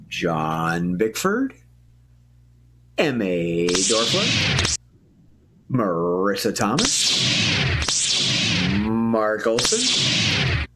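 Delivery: slow, low, stretched names; lingering showman cast list; drawn-out announcer roll call. Acted. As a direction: stretch each name like a grand introduction. Host names cast slowly.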